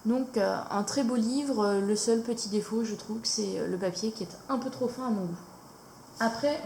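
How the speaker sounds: ordinary talk, nothing marked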